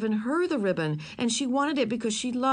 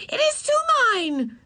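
much higher, louder shriek; little girl shrieking, much higher and louder; 6 dB louder than usual. Acted. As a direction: scream it at him. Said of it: "she shrieked".

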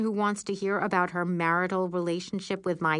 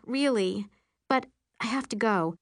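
ordinary delivery, nothing marked

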